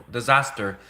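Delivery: ordinary talk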